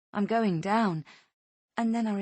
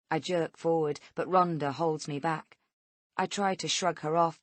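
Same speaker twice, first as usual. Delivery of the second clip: ordinary delivery, nothing marked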